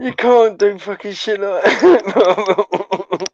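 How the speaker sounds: slow, drawn-out mock disgust; amused scolding, dragged out slowly; mock outrage. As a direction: stretch it out, scolding in fun. Mock outrage at rabbit kicking.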